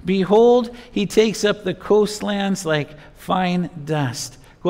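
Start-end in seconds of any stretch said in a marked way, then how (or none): none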